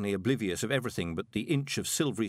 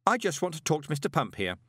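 higher, pointed addition; sharp aside; 7 semitones higher than usual. A narrator's reading. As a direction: add it sharply. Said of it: "added sharply".

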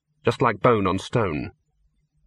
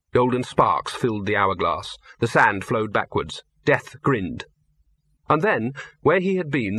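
usual speaking voice

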